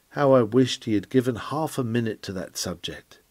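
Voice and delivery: ordinary delivery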